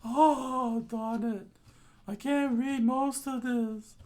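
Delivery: high voice, slow and flustered; high character voice. Acted. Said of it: "Denji said".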